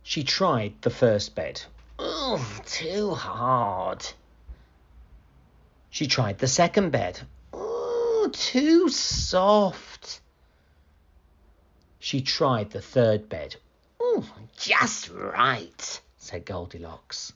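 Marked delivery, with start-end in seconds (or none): 2.0-4.0 slow, drawn-out complaint; displeased Goldilocks. Acted. Goldilocks tries the hard bed. 7.5-10.2 high, drawn-out complaint; high-pitched ooh of discomfort; displeased Goldilocks. Acted. Goldilocks tries the soft bed. 14.0-16.0 slow, relishing sigh of comfort; contented Goldilocks. Acted. Goldilocks finds the right bed.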